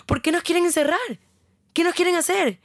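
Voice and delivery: high-pitched alarmed questioning; retold panicked demand. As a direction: demand answers, reenacting panic. Retold panicked confrontation with the men.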